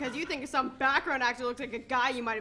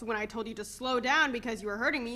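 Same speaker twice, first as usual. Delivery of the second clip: ordinary talk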